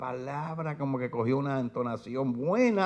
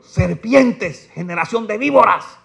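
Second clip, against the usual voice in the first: "Serpientes" and "víboras" called out loud and high; loud rebuke; 8 semitones higher, 12 dB louder, slower than usual. Shown as a demonstration. Preacher showing how to rebuke an auditorium, loud. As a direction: hurl "Serpientes, generación de víboras" at an audience. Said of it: "ese valor para decirle a alguien".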